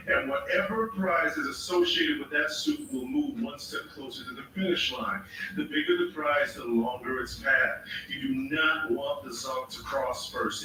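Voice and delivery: usual speaking voice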